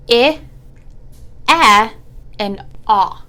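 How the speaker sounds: each short vowel sound said on its own; isolated short vowels for a phonics class. Shown as a demonstration. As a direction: model "I", "eh", "ah" as clipped short vowels. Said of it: "So this one's gonna say".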